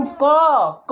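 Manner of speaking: क stretched slowly so the अ at the end is heard; drawn-out क. Shown as a demonstration. Teacher slowing क for students to hear the hidden अ. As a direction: say क slowly, letting the अ ring out. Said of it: "अ आ रहा है".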